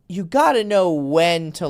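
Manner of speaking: normal talking voice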